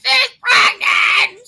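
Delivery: slow, high wailing shout; wailing the news to lawyer on the phone; distraught wail. Retold or imitated.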